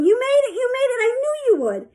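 very high, jubilant shout; excited yelling. A narrator's reading. Coach yelling as Sheila reaches the ladder, jubilant. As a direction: burst out cheering in triumph. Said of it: "Marty was yelling".

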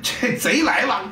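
ordinary delivery

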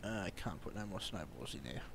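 usual speaking voice

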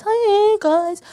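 high, lilting, rising hello; chirpy greeting. Retold or imitated. Comic re-enacting joining an online chat, high rising greeting. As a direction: pitch the greeting up brightly.

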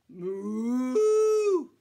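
loud, stretched-out mooing; slow cow moo. Acted. Drawn-out moo.

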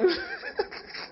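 a high burst of laughter at his own joke. Real, not acted. Preacher laughing at his own malaria joke, high laugh.

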